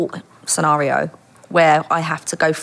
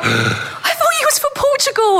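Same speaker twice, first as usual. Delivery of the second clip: very high, slow laughing squeal; shocked disbelief. Retold or imitated. Retelling her shock that he wasn't Portuguese, incredulous. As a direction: wail it in mock dismay. Shocked at learning he was local.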